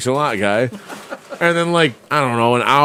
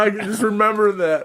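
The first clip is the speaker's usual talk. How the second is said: ordinary talk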